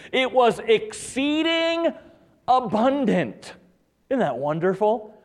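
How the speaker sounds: high, loud, exultant sermon voice; fervent preaching. Real, not acted. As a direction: preach it big, stretching the key words. Preaches on abundant grace.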